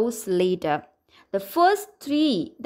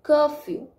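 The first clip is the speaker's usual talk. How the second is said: CUR in "Curfew" higher and stronger, "few" unstressed; stress on first syllable; 4 semitones higher than usual. Shown as a demonstration. Teacher showing stressed and unstressed syllables, "Curfew" stressed on CUR. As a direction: punch the first part of "Curfew", let the second drop. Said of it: "The first part of this word is stressed".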